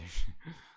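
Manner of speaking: loud, short laugh. Real, not acted. Player amused at the regular clothes, loud laugh. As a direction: burst out laughing.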